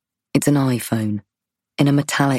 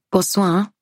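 bright, higher-pitched French greeting; best French accent; 7 semitones higher than usual. Acted. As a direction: put on your best French accent to charm him. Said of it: "I say in my best accent".

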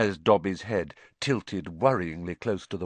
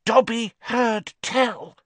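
hoarse; 4 dB louder, slower than usual. A narrator's reading. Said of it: "he said hoarsely".

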